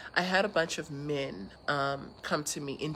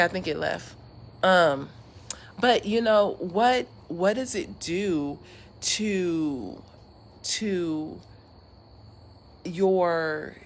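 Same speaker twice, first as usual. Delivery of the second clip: normal talking voice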